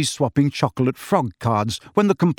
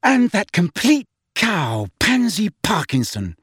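vicious; 7 semitones higher than usual. A narrator's reading. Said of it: "said Hermione viciously".

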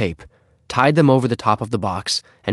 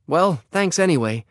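higher, subdued polite thanks; mildly discouraged; 7 semitones higher than usual. Acted. Henry leaves after a refusal, mildly discouraged. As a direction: give up politely. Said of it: "feeling somewhat discouraged".

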